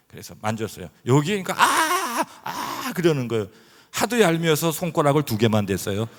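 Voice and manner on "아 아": very high "아" yelp; mock pained yelp. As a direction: yelp at the touch. Wife's cry when touched. (아주 높은 비명 흉내)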